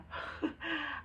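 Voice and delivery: a quick amused laugh; short laugh. Real, not acted. Laughing at her own sarcastic reaction, amused. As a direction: laugh at yourself briefly.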